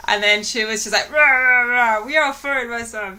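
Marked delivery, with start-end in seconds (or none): 1.1-3.2 loud gibberish growl of anger; mock angry ranting. Girlfriend scolding her for coming home late, mock ranting. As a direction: rant like an angry cartoon.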